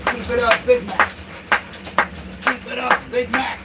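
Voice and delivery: high, sing-song pet voice; doggy talk. Real, not acted. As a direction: coo and cheer the dog along. Cheers dog Big Mac on.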